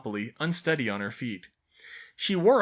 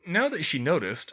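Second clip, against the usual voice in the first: ordinary delivery, nothing marked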